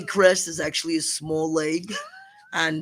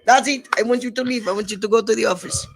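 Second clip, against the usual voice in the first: put-on louder, accented dismissal; fed-up teacher; 3 semitones higher, 4 dB louder than usual. Teacher sending the joker to the office, fed up and accented. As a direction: throw him out of class. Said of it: "She used her lips".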